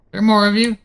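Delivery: high, loud startled question; alarmed surprise. Real, not acted. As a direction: blurt it out, caught off guard.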